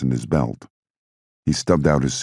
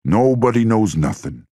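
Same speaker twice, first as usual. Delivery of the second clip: rising fury, higher pitch; bitter heated protest, pitched up; angry outburst; 9 semitones higher, 3 dB louder than usual. A narrator's reading. Angry mob boss rant.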